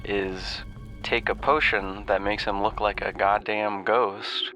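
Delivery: ordinary talk, nothing marked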